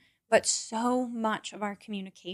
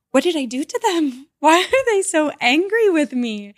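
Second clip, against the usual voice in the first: higher, louder mock panic with a laugh; mock alarm; 7 semitones higher, 7 dB louder than usual. Retelling reacting to a relative's "K period" text, mock alarmed. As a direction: fret half-jokingly about an angry text. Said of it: "And I'm like, gasps".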